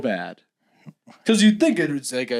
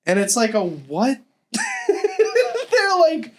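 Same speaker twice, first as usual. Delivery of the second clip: ordinary talk, nothing marked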